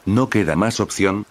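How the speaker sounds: usual speaking voice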